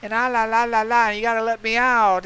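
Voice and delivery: mock the whining with a sneer. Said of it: "Don't be crying and saying".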